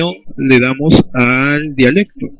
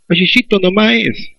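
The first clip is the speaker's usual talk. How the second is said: sing-song Peruvian jungle accent, pitched higher; 7 semitones higher than usual. Shown as a demonstration. Teacher imitates a jungle speaker for students, Peruvian jungle accent. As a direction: lilt it like a speaker from the Peruvian selva. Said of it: "un hablante selvático dice".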